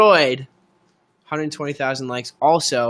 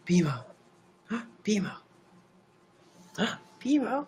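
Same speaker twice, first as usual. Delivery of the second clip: fond cat call; 7 semitones higher, 8 dB quieter than usual. Real, not acted. Calling the cat fondly.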